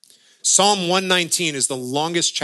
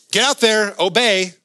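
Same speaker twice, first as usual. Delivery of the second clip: mock command; 6 semitones higher, 4 dB louder than usual.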